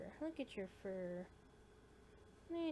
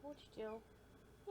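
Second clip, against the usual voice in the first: quiet coo; 10 dB quieter, much slower than usual. Real, not acted. Softly addressing kitten.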